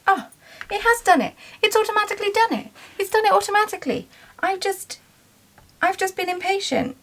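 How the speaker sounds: high fast excited chatter; excited high squeal. Real, not acted. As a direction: squeal it out in delight. Realises sale went through.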